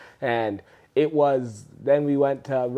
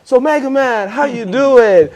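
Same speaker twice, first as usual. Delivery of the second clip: high, eager imitation of a star-struck fan; mimicking locals greeting; 11 semitones higher, 9 dB louder than usual. Locals in South Africa greeting Mega Man, high and eager imitation. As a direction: pitch it way up like locals greeting a celebrity. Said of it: "So they would say".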